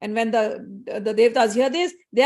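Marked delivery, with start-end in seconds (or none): none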